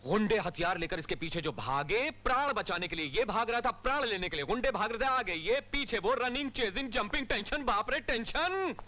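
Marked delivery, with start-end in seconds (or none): 2.1-8.8 theatrical, high-pitched running commentary; excited chase account. Acted. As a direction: build the chase up and stretch the final word. Officer theatrically recounts the chase.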